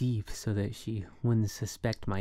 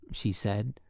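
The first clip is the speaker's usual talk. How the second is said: usual speaking voice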